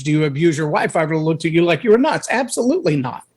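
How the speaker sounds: ordinary talk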